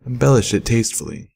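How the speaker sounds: ordinary delivery, nothing marked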